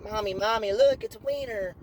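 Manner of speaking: put-on high excited kid calling mom; mock child tattling. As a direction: squeal like a little kid. Little kid pointing.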